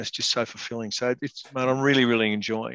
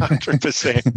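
laughing it out, louder; 3 semitones higher, 6 dB louder, slower than usual. Real, not acted. Agreeing with his co-host's joke through a laugh.

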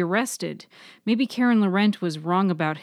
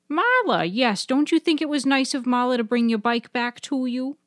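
higher pointed Nana, leaning on you; much higher Nana nudging Bud; prompting grandmother; 7 semitones higher than usual. Acted. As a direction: press him with a high coaxing voice. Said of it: "Nana prompted".

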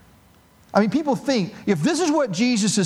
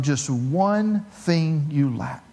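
slow, weighty; 3 semitones lower, much slower than usual. Acted. Jesus' words, then repeated plainly.

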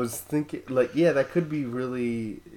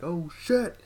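surprised; 10 semitones higher than usual. Retold or imitated. Acted shocked reaction.

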